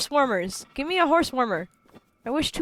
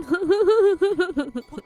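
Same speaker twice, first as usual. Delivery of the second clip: laughing; 8 semitones higher, 5 dB louder than usual. Real, not acted. Laughs at own British bit.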